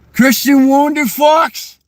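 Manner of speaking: put-on shouted, high, stretched-out New England accent; New Hampshire accent. Imitating locals calling out a name, shouted South Shore accent. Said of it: "they'll pronounce my last name so weird".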